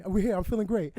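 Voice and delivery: imitating host's podcast voice. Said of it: "look at that voice".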